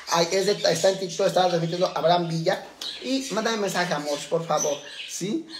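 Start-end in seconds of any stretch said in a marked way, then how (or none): none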